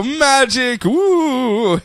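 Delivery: high, hammed-up magic flourish; mock magician. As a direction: squeal it like a cheesy stage magician. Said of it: "Even just go".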